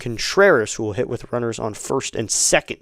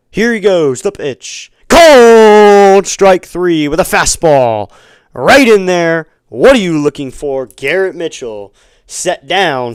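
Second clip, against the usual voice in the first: loud, high strikeout call with a long-drawn first word; excited play-by-play; 4 semitones higher, 9 dB louder, slower than usual. Real, not acted. Announcer calls a called strike three to listeners, excited. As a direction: belt out the strikeout, stretching the big word.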